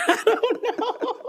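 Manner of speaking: high squeal of laughing words; helpless laughter. Real, not acted. Comic at her own robbery story, helpless real laughter. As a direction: squeeze the words out while cracking up.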